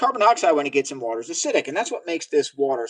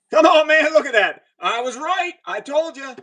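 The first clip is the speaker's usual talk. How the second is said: loud high burst, then gloating; excited triumph; 11 semitones higher, 6 dB louder than usual. Real, not acted. Scientist crowing to viewers over a one-drop result, excited triumph. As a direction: crow it, pitched high.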